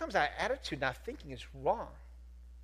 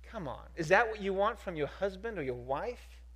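ordinary talk

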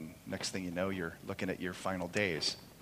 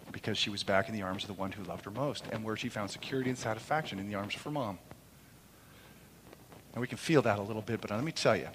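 ordinary talk

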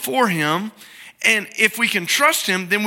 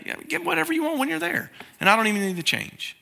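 breezy generous dad; 8 semitones higher, 5 dB quieter, faster than usual. Retold or imitated. Dad handing over twenty bucks.

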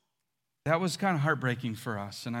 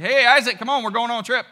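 bright, breezy call to his son, louder and higher; breezy cheerful call; 6 semitones higher, 9 dB louder, faster than usual. Acted. Abraham breezily calling his son Isaac along on a trip, loud and cheerful. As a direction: casually call the boy along like it's nothing. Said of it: "He's like".

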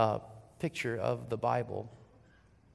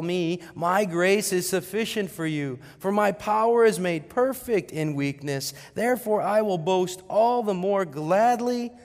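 higher, louder preaching voice; scripture proclamation; 5 semitones higher, 6 dB louder than usual. Acted. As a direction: declare the verse with rising force. Proclaims scripture at raised force.

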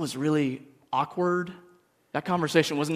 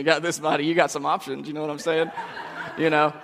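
laughing; 2 semitones higher than usual. Real, not acted. Joking about his body, laughing.

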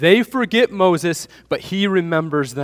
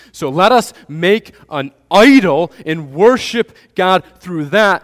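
higher, louder, complaining people who forgot Moses; grumbling crowd; 3 semitones higher, 7 dB louder than usual. Acted. Preacher voicing the Israelites griping about Moses, higher and louder. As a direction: whine it like a crowd that's been left behind.

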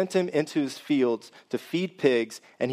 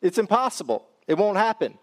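higher-pitched, emphatic refusal; retold emphatic no, pitched up; emphatic denial; 6 semitones higher than usual. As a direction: imitate someone firmly ruling it out. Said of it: "the answer is always an emphatic".

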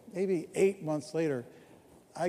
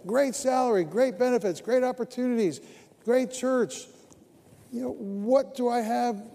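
louder and higher, pressing the point; preaching at full force; 6 semitones higher, 4 dB louder than usual. Real, not acted. Pastor pressing his congregation, preaching at full force. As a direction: build it like a pulpit climax, louder.

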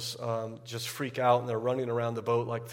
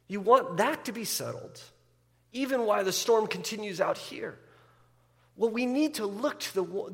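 higher and louder, fervent climax; impassioned preaching; 9 semitones higher, 5 dB louder than usual. Acted. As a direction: proclaim it with rising fervour. Climax of sermon on peace.

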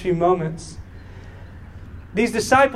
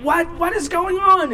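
frantic bafflement; 10 semitones higher than usual. Retold or imitated. Parent over screaming daughter, high frantic bewilderment. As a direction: pitch leaps much higher.

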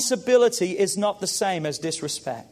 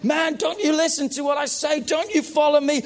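higher, raised frustrated shout; frustrated parent; 8 semitones higher than usual. Retold or imitated. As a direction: vent at a kid who won't listen. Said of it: "it can be like".

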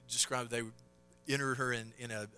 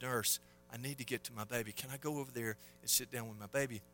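soft, subdued retold plea; hushed request; 2 semitones lower, 7 dB quieter than usual. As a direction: ask the nurse softly, as the father. Father pleads softly with nurse.

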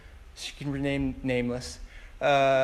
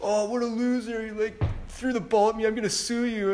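higher, whining schoolkid complaint; whiny kid; 7 semitones higher than usual. Retold or imitated. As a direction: play the hurt bully whining. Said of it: "I remember the kids like".

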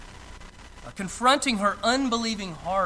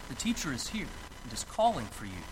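hushed and quick, lower; quiet private message; 9 semitones lower, 8 dB quieter, faster than usual. Acted. Martha hushed and quick, telling her sister privately. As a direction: pass it along softly, just for her. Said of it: "saying in private".

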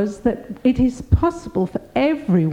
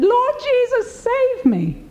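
a retold plea shouted high to Jesus; desperate cry; 14 semitones higher than usual. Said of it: "I yelled at the top of my voice".

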